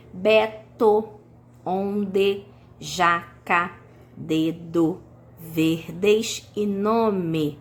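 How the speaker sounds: palavras partidas em duas sílabas, bem lentas; separando sílabas. Shown as a demonstration. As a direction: dite sílaba por sílaba, com pausas. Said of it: "têm duas sílabas". (silabando devagar)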